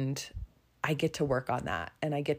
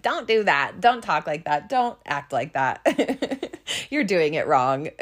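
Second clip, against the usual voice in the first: put-on wag a finger as a cranky old woman, half laughing; bossy old woman; 2 semitones higher, 5 dB louder than usual. Imagining her future old self scolding people, as a mock crabby old lady. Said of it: "what I might be as an old woman".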